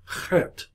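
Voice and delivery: "Chet" with a half-strength throaty ch; heavier H. Shown as a demonstration. Teacher demonstrating the letter, "Chet" with a heavier H. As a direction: start "Chet" on a heavy, breath-driven H. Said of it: "so it's a heavier H".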